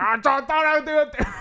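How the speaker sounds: high, stammering, sheepish mock voice; dopey Angelo. Angelo sheepishly excusing himself, high dopey stammer. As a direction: play the clueless guy fumbling an excuse.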